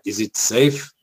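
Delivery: lightly voiced film villain's question; a hint of a menacing movie line; quoting Marathon Man. Dad and son joking at home, a lightly menacing quote. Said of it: "we go like".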